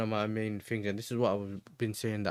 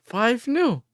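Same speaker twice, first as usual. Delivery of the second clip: surprised; 11 semitones higher, 7 dB louder than usual. Real, not acted. Disbelief at prediction.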